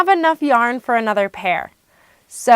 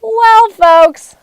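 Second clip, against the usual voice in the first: host voice; 11 semitones higher, 8 dB louder than usual. Pretend. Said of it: "So, Marta, what did you do".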